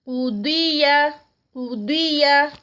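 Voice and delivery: قدیہ with thick ق and heavy ض, drawn out slowly; heavy qaf and dad. Shown as a demonstration. Teacher drilling قدیہ with heavy letters. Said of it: "قاف ہیوی، داد ہیوی".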